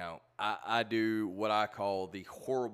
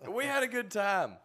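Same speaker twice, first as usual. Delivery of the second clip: normal talking voice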